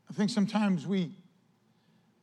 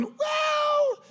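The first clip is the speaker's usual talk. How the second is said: a single high, drawn-out, loud well; a lightly voiced Jesus setting him straight; knowing Jesus; 4 dB louder than usual. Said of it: "Could you imagine Jesus going".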